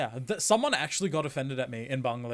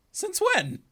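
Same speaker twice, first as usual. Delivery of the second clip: high, loud incredulous squeak; shocked he never knew; disbelief; 7 dB louder than usual. Acted. Squeaking in disbelief at a forgotten childhood friend. Mock disbelief at shared past.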